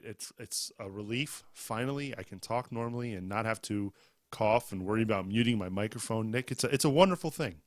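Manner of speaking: ordinary delivery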